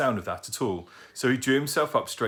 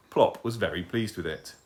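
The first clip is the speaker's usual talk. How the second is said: ordinary delivery, nothing marked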